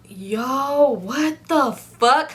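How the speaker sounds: drawn-out stunned what the fuck; slow it right down in disbelief; shocked reaction. Retold or imitated. Reading the diary about herself, slow shocked disbelief.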